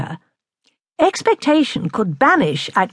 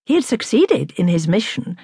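ordinary delivery, nothing marked

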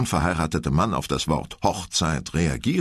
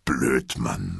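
laconic; 7 semitones lower than usual. Acted. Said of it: "meinte Lehrer lakonisch".

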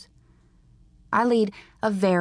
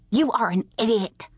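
higher-pitched, exasperated insult; flustered spewing; 6 semitones higher than usual. A narrator's reading. Bug insulting her sister over the high tops, flustered. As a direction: spit it out, all flustered. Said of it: "she spewed, all flustered".

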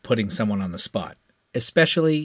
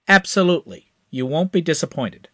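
higher, confident hotshot; eager young pilot; 7 semitones higher, 4 dB louder than usual. A narrator's reading. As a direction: promise it with cocky eagerness. Crash voiced higher, eager.

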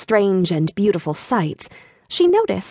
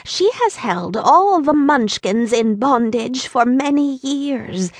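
put-on slow, higher little old woman voice; a lightly voiced old lady; 5 semitones higher than usual. A narrator's reading. Old woman answering Dorothy, slow and higher. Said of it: "the little woman".

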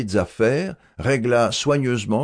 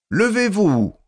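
ordre du professeur; 11 semitones higher, 4 dB louder than usual. A narrator's reading. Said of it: "reprit le professeur".

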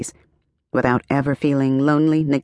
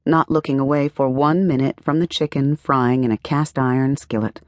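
ordinary delivery, nothing marked

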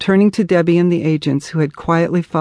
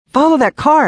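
sudden high-pitched cry; urgent shout; 10 semitones higher, 4 dB louder than usual. A narrator's reading. Psychic spotting the car again, urgent shout. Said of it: "I shouted".

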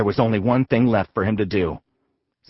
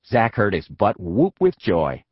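ordinary delivery

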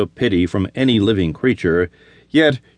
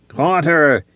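higher gasping cry; gasped; 5 semitones higher than usual. Acted. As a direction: spit out "Caught her!" on a gasp. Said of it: "he gasped".

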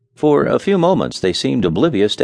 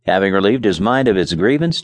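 ordinary delivery

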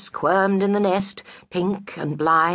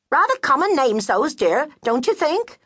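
much higher, prim, sniffy disapproval; snooty friend; 10 semitones higher than usual. Acted. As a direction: look down your nose at the names. Friend voice, snobbish.

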